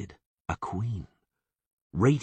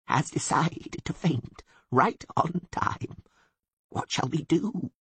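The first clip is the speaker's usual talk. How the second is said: quiet bleating; 9 semitones higher, slower than usual. A narrator's reading. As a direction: bleat a hushed aside to a companion. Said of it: "he bleated quietly".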